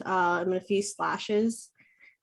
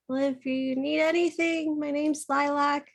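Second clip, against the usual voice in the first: put-on higher, light friendly goblin girl; 7 semitones higher than usual. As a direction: offer help to the travelers. Goblin girl introduces herself.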